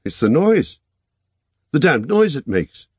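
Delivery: high, loud cry of despair; a young man overwhelmed by a remembered horror. A narrator's reading. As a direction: break out in helpless anguish. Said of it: "he said in despair".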